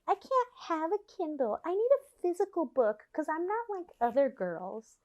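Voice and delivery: high, soft put-on precious voice; mock your own fussy pickiness in a small high voice; annoying self-mockery. Mocking her own love of physical books, annoying self-mockery. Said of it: "I'm so annoying in that way".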